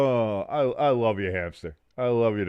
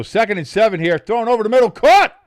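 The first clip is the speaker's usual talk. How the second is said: building to a high loud shout; excited play call; 10 semitones higher, 8 dB louder than usual. Real, not acted. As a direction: call the catch like a broadcaster.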